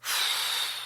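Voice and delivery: soft breathy defeated sigh; quiet giving-up exhale; resigned sigh. Retold or imitated. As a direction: let the air out in defeat. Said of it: "Jumba and Pleakley are like".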